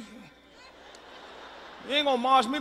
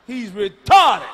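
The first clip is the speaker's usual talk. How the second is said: slow, loud flat correction; louder, slower blunt retort; blunt shout; 14 dB louder, much slower than usual. Pretend. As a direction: bellow it to undo the polite term. Mocking new polite term.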